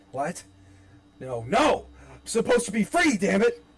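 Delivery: terrified, rising to a loud high yell; panicked shout. Acted. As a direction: scream in denial. Will waking in nightmare hospital.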